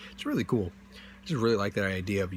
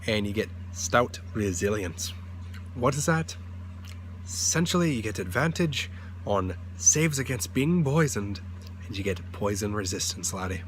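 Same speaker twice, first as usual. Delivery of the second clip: Scottish dwarf voice. Pretend. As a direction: play a gruff Scottish dwarf. Dwarf voice, calls listener laddie.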